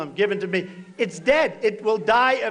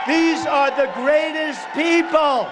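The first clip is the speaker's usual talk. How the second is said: slow emphatic shout; 5 semitones higher, slower than usual. Real, not acted. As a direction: drive the praise home slowly. Praising police to crowd.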